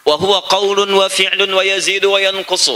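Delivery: measured, chanted Arabic quotation; Arabic recitation. Shown as a demonstration. Preacher quoting the creed text in recitation style. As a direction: recite the Arabic line formally. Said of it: "recites Arabic text".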